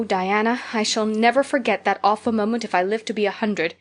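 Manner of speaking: ordinary delivery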